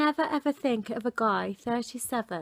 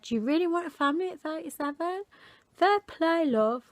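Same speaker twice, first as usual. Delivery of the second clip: mocking; 6 semitones higher than usual. Mocking the man's message.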